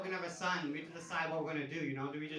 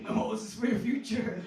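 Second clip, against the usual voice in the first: mock stern; 5 semitones higher, 5 dB louder than usual. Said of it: "I'm like".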